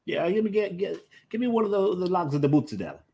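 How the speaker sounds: high-pitched Jersey Italian deli order; Brooklyn Italian customer. Acted. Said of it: "you've got the Northern Italian, Brooklyn, ordering".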